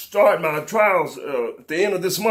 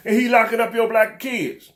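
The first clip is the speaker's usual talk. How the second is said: normal talking voice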